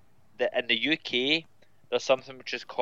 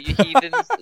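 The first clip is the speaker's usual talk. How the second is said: higher, louder mock indignation; mock outrage; 8 semitones higher, 8 dB louder than usual. Writer ribs American editors over spelling, in mock outrage. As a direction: playfully scold them, voice jumping up.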